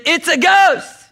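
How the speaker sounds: high, drawn-out cry of alarm; terrified cry. Acted. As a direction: cry out in acted terror. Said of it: "they were terrified".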